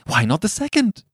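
high, eager feverish question. A narrator's reading. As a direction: push the question urgently. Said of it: "said the old woman feverishly".

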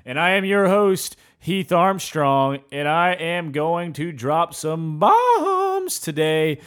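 showman announcer hype; ringmaster. Acted. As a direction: sell the show like a ringmaster. Hyped announcer intro.

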